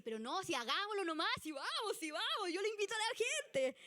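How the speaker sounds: rally everyone, let's just do it; eager go-getter. Retold or imitated. Impulsive member pushing the group, high and excited. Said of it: "Entonces tú decís".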